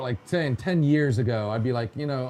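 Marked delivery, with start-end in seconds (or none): none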